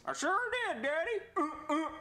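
put-on high kid voice; Jeffy voice. Son answering Daddy in a high kid voice. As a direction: chirp back to Daddy.

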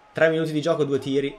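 ordinary talk

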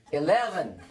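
a put-on American accent that comes out Irish; American accent gone Irish; attempted American accent. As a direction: try to sound American, land on Irish. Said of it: "You need to try an American accent".

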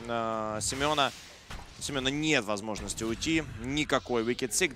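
ordinary delivery

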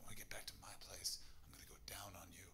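hushed dirty whisper on the night bus. Retold or imitated. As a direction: breathe it softly, no voice. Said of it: "she turned to me and she went".

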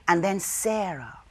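plain reading voice

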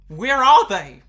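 a loud, demanding yell of a question. Real, not acted. Streamer to the viewer who hid the builds, a loud demand. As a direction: bellow it out, demanding an answer.

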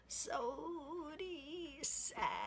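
put-on high, slow creaky old man's voice; lightly creaky elder calling out. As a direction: stretch it out like a rickety old man. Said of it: "it sounded something like this".

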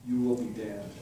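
ordinary delivery

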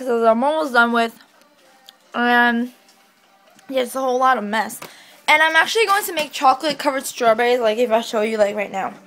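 usual speaking voice

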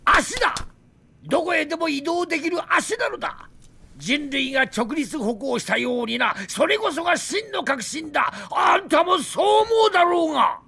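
fervent yelling about his invention; passionate shout. Acted. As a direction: shout your pride at the listener. Drunk scientist rants about his design.

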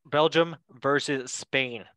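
ordinary delivery

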